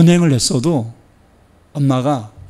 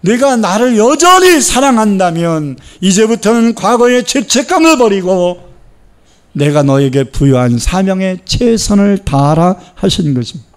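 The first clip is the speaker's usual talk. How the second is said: louder, higher impassioned sermon; fervent preaching; 4 semitones higher, 4 dB louder than usual. Real, not acted. Pastor exhorting the congregation, fervent preaching. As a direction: drive the message home.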